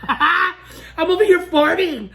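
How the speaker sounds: laughing, high and slow. Real, not acted. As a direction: giggle through it. Laughing at her own burps.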